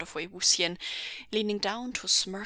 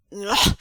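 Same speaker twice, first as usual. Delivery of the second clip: loud throaty "ugh"; disgusted noise from back of throat; exasperated, forceful groan; disgusted grunt; 10 dB louder than usual. Acted. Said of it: "made a disgusted sound".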